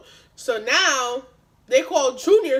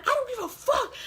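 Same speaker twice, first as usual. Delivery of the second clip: high-pitched re-enacted defiance; acted-out angry comeback; defiant retort; 12 semitones higher than usual. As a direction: throw it back at him, voice way up. Acted quarrel with big guy.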